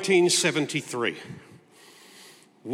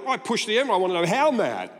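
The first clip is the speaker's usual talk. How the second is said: ordinary talk, nothing marked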